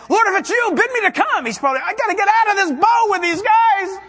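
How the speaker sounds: high, loud panicky Peter begging to get out; shouting Peter, high and loud with fear; frantic Peter. Retold or imitated. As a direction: play a terrified disciple yelling from the boat. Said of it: "he's saying".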